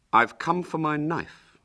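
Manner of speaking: put-on high boy's voice, mildly stern. William confronting Hubert for his knife, mildly stern. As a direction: demand it with a touch of firmness. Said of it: "said William sternly".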